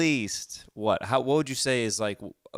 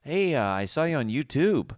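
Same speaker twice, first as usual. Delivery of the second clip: put-on older dad putting odd, high stress on "YouTube"; wrong-word emphasis; 5 semitones higher than usual. Son imitates Dad mentioning his videos, odd emphasis on "YouTube". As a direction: lean oddly on "YouTube", pitched up, like an older parent. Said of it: "older people emphasize the wrong word".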